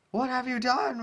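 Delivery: high, loud accusing cry; outraged question; horrified demand. Acted. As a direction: shout your horror at her. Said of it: "Rainbow questioned".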